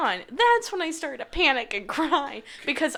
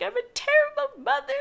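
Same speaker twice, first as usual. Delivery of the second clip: mock wail; 13 semitones higher, 3 dB louder than usual. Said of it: "bawling at this point".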